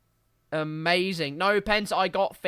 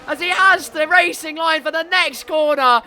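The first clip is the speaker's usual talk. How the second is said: excited shout; 10 semitones higher, 10 dB louder than usual. Real, not acted. Overtake called live.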